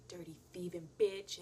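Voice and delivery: whispered jab under the breath; sly whisper. Retold or imitated. Aunt muttering sly jabs at the roommate, whispered. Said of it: "these little sly comments".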